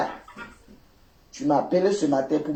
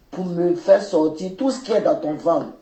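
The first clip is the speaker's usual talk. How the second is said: ordinary talk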